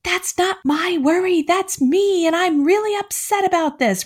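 high-pitched imitation of a protesting child; upset kid. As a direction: put on a kid's high voice, insisting. Voicing kids who object.

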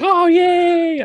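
very high, loud mock cheer; acted cheering. Parents celebrating toddler's potty success, over-the-top retold cheering. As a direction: whoop it up like a proud parent.

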